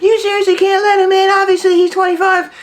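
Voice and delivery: squeaky high mock whiny voice; high-pitched mocking whine; mocking friends. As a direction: mimic the annoyed friends in a shrill falsetto. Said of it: "They're like".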